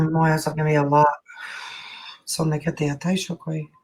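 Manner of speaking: lightly chanted flowing syllables, softening at the ends; light language. Acted. Healer channelling for the client, a soft flowing chant. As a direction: let unknown words flow like a channelled prayer.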